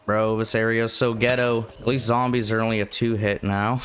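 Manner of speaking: ordinary talk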